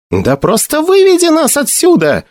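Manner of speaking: high-pitched exclamation; urgent high outburst, not a full shout; crying out. A narrator's reading. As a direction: cry out, voice leaping up high. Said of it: "вскрикнул Маград".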